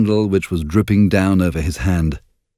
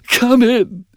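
high, breaking sobbed cry; sobbing plea; 3 dB louder than usual. A narrator's reading. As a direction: let the voice crack into a sob. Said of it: "he sobbed".

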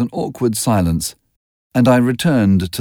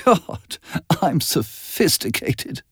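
slow, scornful self-mockery, trailing softer; mocking; 7 semitones higher, 4 dB quieter, slower than usual. A narrator's reading. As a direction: sneer at yourself with a sigh.

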